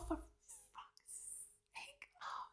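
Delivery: slow, breathy whisper of despair; whispered exasperation. Real, not acted. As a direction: whisper it, defeated. Exasperated at the cliffhanger.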